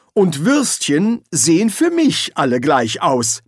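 snapped retort. A narrator's reading. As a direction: fire back, mildly angry. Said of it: "blaffte Silberfisch zurück".

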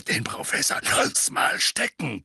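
rough growled brush-off; growling voice. Acted. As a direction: growl it like a gruff old veteran. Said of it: "knurrte die Stimme".

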